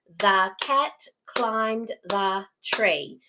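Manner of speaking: very slow, a gap after every word. Shown as a demonstration. Teacher repeats sentence to count words, slowly segmented. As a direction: separate each word for a child to count. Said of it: "how many words did I say?".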